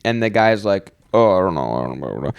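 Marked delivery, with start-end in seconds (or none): 1.1-2.3 a little weird. Retold or imitated. Pulled-over driver answering the cop, being a little weird. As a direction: trail down low, dodging the cop's question. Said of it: "kinda being a little bit weird".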